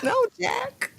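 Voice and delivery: high, hammed-up plea; mock plea. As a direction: cry out his name in mock despair. Voicing a character pleading.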